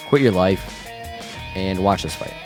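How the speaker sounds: usual speaking voice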